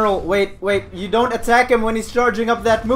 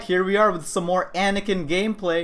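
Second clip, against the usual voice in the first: ordinary delivery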